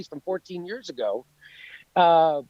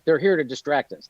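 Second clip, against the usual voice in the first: ordinary delivery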